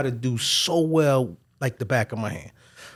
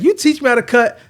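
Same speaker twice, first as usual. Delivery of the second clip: put-on excited kid; 5 semitones higher, 5 dB louder than usual. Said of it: "He's like".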